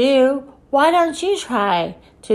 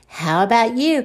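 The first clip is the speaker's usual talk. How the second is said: usual speaking voice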